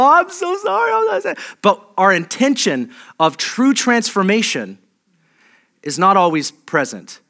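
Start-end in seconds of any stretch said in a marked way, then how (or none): 0.0-1.4 much higher, gushing mock sorry; emotional apology. Worshipper rushing the altar on raw emotion, high gushing apology. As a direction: burst out pleading like an overwhelmed penitent. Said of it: "we'll burst forward and go".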